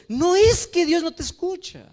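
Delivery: ordinary talk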